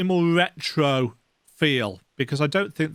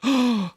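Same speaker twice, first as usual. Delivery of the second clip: gasp; 10 semitones higher than usual. Acted. Voicing guard reacting.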